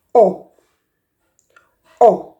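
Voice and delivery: "O" open and loud; short o. Shown as a demonstration. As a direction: push out a firm "O". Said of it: "Another sound of the letter A is".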